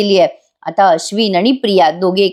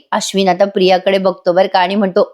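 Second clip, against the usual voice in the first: ordinary talk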